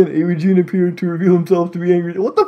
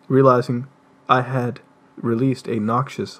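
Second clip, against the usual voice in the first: normal talking voice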